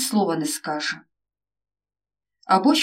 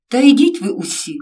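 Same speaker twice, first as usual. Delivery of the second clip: put-on loud outburst of a hurt teen; shouting; 4 semitones higher, 6 dB louder than usual. A narrator's reading. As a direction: yell it at everyone and storm off. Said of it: "закричав Роман". (син кричить на батьків)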